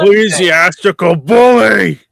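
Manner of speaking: loud, drawn-out theatrical bellow; big mock-angry yell; mock outraged shout. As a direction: bellow it like a hammed-up insult. Mock outburst at co-host.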